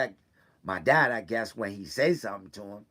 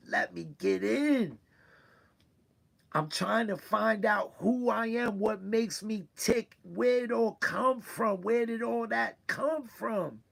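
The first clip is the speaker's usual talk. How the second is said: higher, slow, strained with real hurt; pleading to be let in by family, voice rising; hurt son pleading with his family, slow and high; emotional, near tears; 10 semitones higher, slower than usual. Real, not acted. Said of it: "please pardon me if I get emotional".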